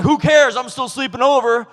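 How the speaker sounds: loud, high mock holler; hammed-up loud and carefree friend; brash best friend. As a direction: crash in like an overfamiliar buddy. Acts the best friend barging in.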